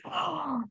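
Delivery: high, drawn-in "oh" of shock; retold sharp intake of shock; demonstrated startled gasp. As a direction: gasp as if the throat tightens. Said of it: "like this".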